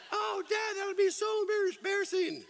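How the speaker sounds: hammed-up teen groan at dad; whiny teen daughter. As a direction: whine it like an embarrassed teenager.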